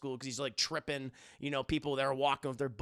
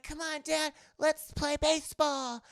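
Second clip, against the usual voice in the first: put-on high, eager little kid voice; kid Max voice; 11 semitones higher than usual. Streamer voicing young Max asking his dad to play. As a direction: chirp it up like a young son. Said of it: "And it's like".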